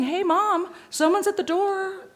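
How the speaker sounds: high eager dog voice; be the dog alerting its owner; voicing the dog. Dog telling its owner someone's at the door, high eager voice. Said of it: "I'm just saying".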